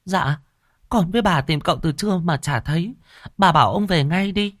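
put-on high, anxious young servant girl; maid Cúc's voice. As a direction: urge the master home, pitched high like a girl. Cúc pleads again. (giọng cô hầu gái lo lắng, cao)

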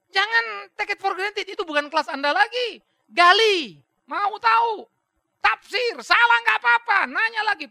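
urgent pulpit charge to dig into scripture; fiery preaching. Acted. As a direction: drive each command home from the pulpit. Preacher exhorting at full force.

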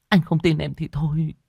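high, pouty sulk. A narrator's reading. Girlfriend sulks because her boyfriend doubts her. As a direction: pout and turn away. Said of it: "Ngọc đẩy Hoàng ra hờn dỗi".

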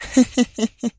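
high, short villainess laugh; short playful laugh. A narrator's reading. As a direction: toss off a teasing little giggle. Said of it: "أطلقت ضحكة عابثة قصيرة". (ضحكة عابثة قصيرة)

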